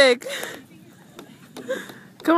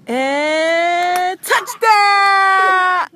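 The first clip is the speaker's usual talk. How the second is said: drawn-out shout; mock touchdown call; 6 semitones higher, 5 dB louder, much slower than usual. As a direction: call it like a sportscaster.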